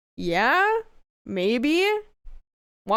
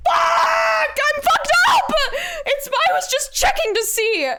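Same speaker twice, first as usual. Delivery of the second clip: high, loud embarrassed wail; flustered outburst; 5 dB louder, slower than usual. Real, not acted. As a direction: shriek it, then protest in a high voice. Flustered outburst after embarrassing confession.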